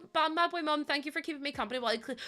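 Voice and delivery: ordinary talk, nothing marked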